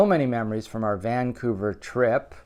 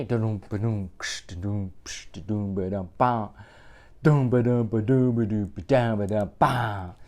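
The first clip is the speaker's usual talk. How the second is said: quiet mouth drumbeat; beatbox the drums softly; soft vocal drumming; 3 semitones lower, 4 dB quieter than usual. Acted. Reader drums the refrain quietly for the kids.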